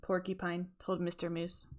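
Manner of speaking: ordinary delivery, nothing marked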